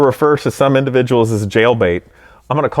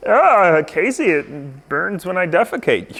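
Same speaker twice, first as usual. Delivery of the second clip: whiny voice; 4 semitones higher, slower than usual. Pretend. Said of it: "whiny reply voice".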